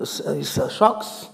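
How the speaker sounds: ordinary delivery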